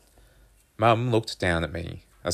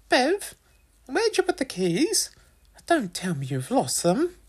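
high-pitched mother voice; accusing mum. A narrator's reading. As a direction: question your child accusingly, pitched way up. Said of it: "She looked at me accusingly".

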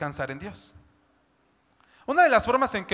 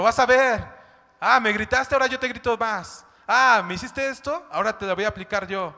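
louder, vengeful threat; vengeful soul shouting; 4 semitones higher, 6 dB louder than usual. Acted. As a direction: spit out the grudge. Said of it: "Eso es lo que grita nuestra alma". (alma que grita venganza)

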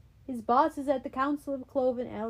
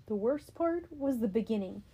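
ordinary delivery, nothing marked

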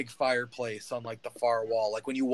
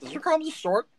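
higher, louder, outraged repeat; indignant; 5 dB louder than usual. Acted. Gnome Stitch confronts the man who called him short, outraged. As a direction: blow up at the insult this time. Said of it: "Hang on a second".

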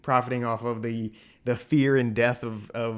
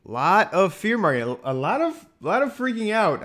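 rising higher, livelier; animated; 9 semitones higher, 3 dB louder than usual. Real, not acted. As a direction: pick up the energy, voice climbing. Echoing fear mongering.

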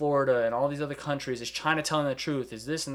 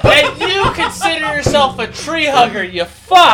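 high, loud scolding through laughter; outraged shout; 12 semitones higher, 14 dB louder, slower than usual. Real, not acted. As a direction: bawl out a friend in amused outrage. Mock outrage at friend's littering.

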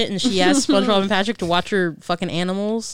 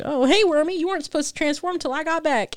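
high-pitched, breezy imitated greeting; unfazed Sandy greeting; 9 semitones higher than usual. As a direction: greet it lightly in a raised voice. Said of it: "she took it like a champ".

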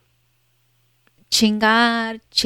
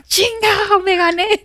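excited; 13 semitones higher than usual. Retold or imitated.

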